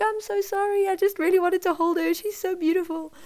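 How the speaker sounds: high, gushing apology, imitated; besotted husband. Husband apologising after holding the baby, high imitated gushing. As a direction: imitate a smitten new dad pleading sorry.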